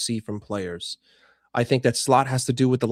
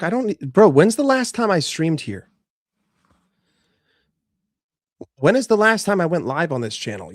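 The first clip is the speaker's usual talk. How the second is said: exasperated; 5 semitones higher, 6 dB louder than usual. Real, not acted. Defending himself to chat.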